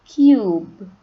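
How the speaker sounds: Cube said loud with a long U like kyoob. Shown as a demonstration. Teacher repeats the right Cube loudly. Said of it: "Not cub. Cube".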